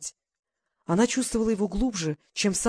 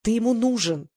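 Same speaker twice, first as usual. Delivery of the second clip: emphatic, higher-pitched interjection; interrupting; 4 semitones higher, 4 dB louder than usual. A narrator's reading. Glasha contradicts him about his son, interrupting insistently. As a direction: cut in insistently, lifting the voice. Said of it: "перебила Глаша".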